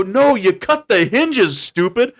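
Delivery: slow, high exasperated correction; scolding crew. Retold or imitated. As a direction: talk down to the rookie slowly. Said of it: "they go".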